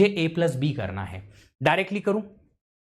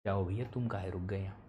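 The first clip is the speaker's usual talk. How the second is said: low, much softer aside; quiet aside; 7 semitones lower, 12 dB quieter, faster than usual. Real, not acted. Teacher puzzling over why the live stream stalled, quiet aside. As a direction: drop out of lecture voice, low and soft.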